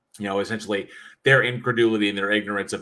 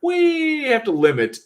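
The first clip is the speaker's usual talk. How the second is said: much higher, slow put-on voice of a prim YouTube rep; mock official voice; 6 dB louder, slower than usual. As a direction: lecture him in a squeaky officious voice. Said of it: "He's like".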